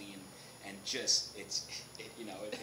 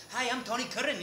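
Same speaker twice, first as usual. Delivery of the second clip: put-on light Scottish accent, higher; Tony's Scottish accent; 12 semitones higher, 5 dB louder than usual. Tony introducing himself, light Scottish accent. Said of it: "his accent".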